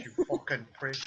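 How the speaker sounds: ordinary talk, nothing marked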